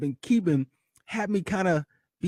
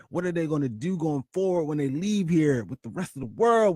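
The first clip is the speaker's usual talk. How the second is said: normal talking voice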